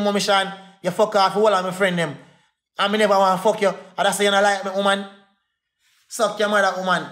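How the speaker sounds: usual speaking voice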